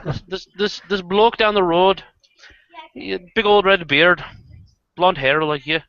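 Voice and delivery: put-on Irish brogue telling a yarn; Irish pub storyteller; Irish accent. Shown as a demonstration. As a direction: spin a tale in an Irish accent. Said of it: "you should hear my Irish accent".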